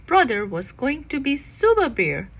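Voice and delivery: ordinary delivery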